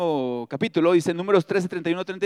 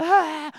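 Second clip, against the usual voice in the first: high, drawn-out pretend wail; mock crying wail; 9 semitones higher than usual. Shown as a demonstration. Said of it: "¿Quién es experto en llorar?".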